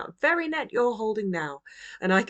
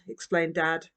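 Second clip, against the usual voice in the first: ordinary delivery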